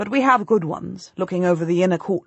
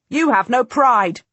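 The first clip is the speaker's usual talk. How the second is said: louder, higher contemptuous jab; spat insult; 6 semitones higher, 7 dB louder than usual. A narrator's reading. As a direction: spit the words at him in scorn. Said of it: "Edward of March spits at him".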